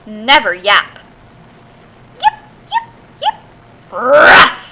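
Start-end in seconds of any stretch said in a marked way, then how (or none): 2.1-3.9 tiny high yips, the first two pitched way up, the last one breathy; puppy yips. Acted. Puppies yipping, taught to yip. 3.9-4.6 louder, gruff little woof; gruff puppy bark. Acted. Big pup yaps instead.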